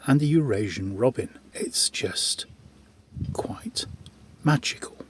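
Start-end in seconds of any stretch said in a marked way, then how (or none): 1.5-5.0 hushed, breathless whisper of wonder; awed whisper. Real, not acted. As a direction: whisper it softly, as if in awe. Awed at peaceful forest.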